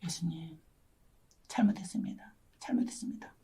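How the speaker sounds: hushed, broken plea for forgiveness; tearful whispered repentance. Retold or imitated. Preacher reliving her repentance, hushed and tearful. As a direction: whisper it like a sobbing confession. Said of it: "대성통곡을 하고 회개했어요".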